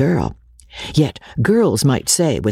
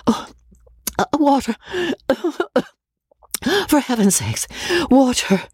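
much higher, desperate plea; crying out; 13 semitones higher than usual. Acted. Rebecca with a burning mouth, desperately crying for water. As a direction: beg for water as your mouth burns. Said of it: "she cried".

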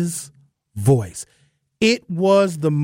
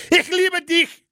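harsh, high, barked German phrase; menacing German; slower than usual. Shown as a demonstration. Talk radio host mocks German love words, menacing. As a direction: say it like a threat in German. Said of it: "sounds like they're about to kill".

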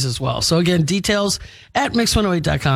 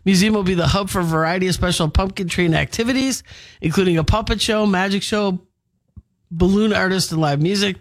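ordinary talk, nothing marked

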